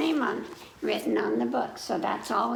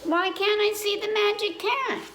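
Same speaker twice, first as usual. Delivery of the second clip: much higher, pleading puppet voice; 11 semitones higher than usual. Acted. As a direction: whine it like a curious little monkey puppet. Puppet monkey whines a question.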